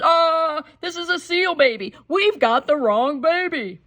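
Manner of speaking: father shouting in shock; high startled we've got the wrong baby; father's yell. Acted. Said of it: "and he yelled".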